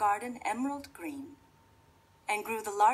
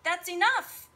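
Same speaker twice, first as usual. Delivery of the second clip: firm shout; 11 semitones higher, 10 dB louder than usual. Acted. Rosaline yells at fairy.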